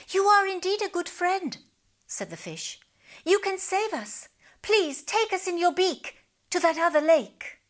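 grateful fish. A narrator's reading. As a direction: thank him with relief.

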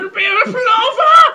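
high, loud mock outburst; hammed-up exclamation. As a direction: blurt it high and loud, playfully. Hammed-up play on palaver.

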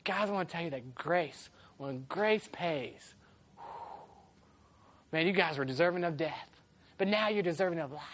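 dramatic preacher. Pretend. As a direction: ham up the solemn drama. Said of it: "that sounds so dramatic".

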